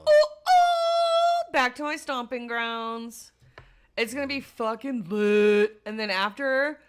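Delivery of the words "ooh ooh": high, loud drawn-out oohs; sustained high hoot of excitement; excited whooping. Real, not acted. Excited about going back to Omaha.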